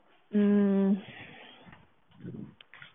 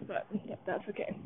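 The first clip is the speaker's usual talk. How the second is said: whispered; 9 dB quieter than usual. Real, not acted. Cold night walk, shrugging it off.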